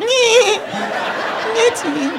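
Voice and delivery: tiny high, soft squeaky voice; a little dough creature squeaking; doughboy voice. Retold or imitated. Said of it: "It's more like".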